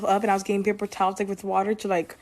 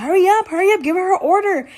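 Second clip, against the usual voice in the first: high and loud rushing shout; coworker shouting hurry; 11 semitones higher, 7 dB louder than usual. Retold or imitated. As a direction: yell it like a stressed coworker. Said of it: "Sabrina was telling me".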